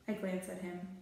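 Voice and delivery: plain reading voice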